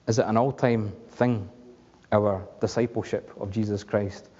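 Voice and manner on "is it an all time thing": usual speaking voice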